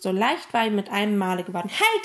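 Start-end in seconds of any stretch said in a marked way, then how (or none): 1.7-2.0 high, loud, quick cry; sudden shout. A narrator's reading. Emil suddenly relieved by an idea, shouting out. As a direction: burst out excitedly to stop everyone. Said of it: "schrie Emil plötzlich".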